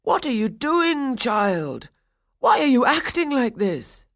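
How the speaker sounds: put-on high, loud alarmed old man; desperate and bewildered. A narrator's reading. Toymaker pleading with his wild doll, high and alarmed.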